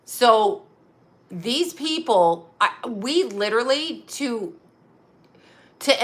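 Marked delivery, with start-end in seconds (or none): none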